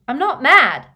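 loud, high protest; shouted denial. Acted. Boy insisting to the professor, shouted denial. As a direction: yell it at him.

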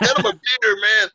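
high, loud drinking-buddy cheer; excited bar patron. Retold or imitated. Bar patron ordering for the Irish guest, excited shouting. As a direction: call out loudly, thrilled and impressed.

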